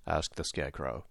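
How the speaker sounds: ordinary delivery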